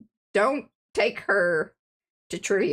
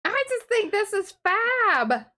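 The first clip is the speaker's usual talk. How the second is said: delighted; 13 semitones higher, slower than usual. Real, not acted. Admiring her work.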